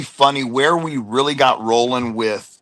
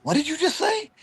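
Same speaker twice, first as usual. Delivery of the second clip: puzzled partner; 5 dB quieter than usual. Retold or imitated. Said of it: "And he goes".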